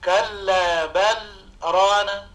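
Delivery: slow recitation, small stop after بل before ران; measured Quranic reading of كلا بل ران; slow with a pause. Shown as a demonstration. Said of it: "هذه اللام عندما تقرأ".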